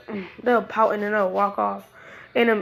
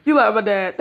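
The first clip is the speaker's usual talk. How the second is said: louder sing-song teasing; loud tease; 6 dB louder than usual. Acted. Naruto teasing the blushing girl, louder and mocking. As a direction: rub it in loudly.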